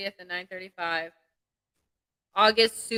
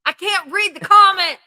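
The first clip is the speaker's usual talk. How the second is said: frustrated whine; 12 semitones higher, 15 dB louder, slower than usual. Real, not acted. Can't read without her glasses.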